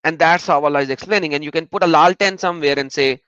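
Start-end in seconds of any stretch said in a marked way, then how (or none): none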